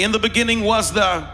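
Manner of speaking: call and response. Real, not acted. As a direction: lead the crowd through it, pausing for their word. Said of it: "come on, say it with me".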